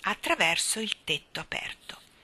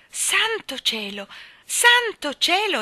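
amazed cry, building higher and louder; esclamazione stupita; 11 semitones higher, 6 dB louder, slower than usual. A narrator's reading. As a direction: draw it out slowly, then let it soar. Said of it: "esclamò Jane". (esclamazione di stupore in crescendo)

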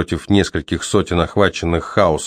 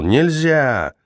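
high commanding yell; 8 semitones higher, slower than usual. Acted. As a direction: shout them down. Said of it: "заорал Кол".

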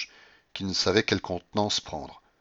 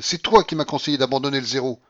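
higher, louder reproach; accusing; 6 semitones higher, 7 dB louder than usual. A narrator's reading. Grandmother blaming her companion for bad advice, accusing. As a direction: pin the blame on them.

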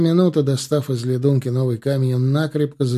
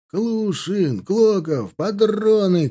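high, urgent calls; shouting; 9 semitones higher, 4 dB louder, much slower than usual. A narrator's reading. Dragoons calling their carriers for cartridges, shouting. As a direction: holler each word high over the firing. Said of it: "кричали драгуны".

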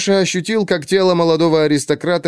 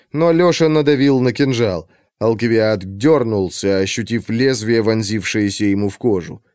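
ordinary delivery, nothing marked

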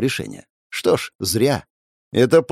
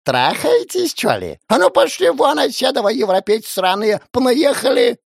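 put-on angry old granny; 12 semitones higher than usual. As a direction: scold them like a furious granny. Granny yells at them.

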